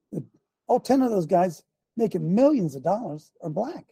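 ordinary talk

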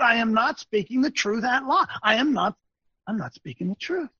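ordinary delivery

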